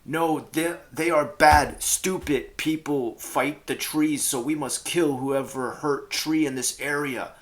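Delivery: loud, high, clumsy rallying speech; mock the captain's dumb battle cry; inarticulate captain speech. Player mocking the captain's clumsy speeches, loud and high. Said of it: "his speeches would just be like".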